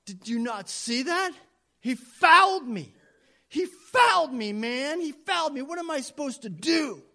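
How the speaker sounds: high, loud outraged protest; furious jockey. Retold or imitated. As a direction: yell fouled me as if cheated. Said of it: "And the jockey goes".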